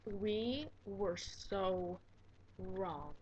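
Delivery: very slow, quiet, word by word; ominous slow reveal. Real, not acted. Telling viewers they misjudged the kids, slow hushed regret. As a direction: drop low and space out each word.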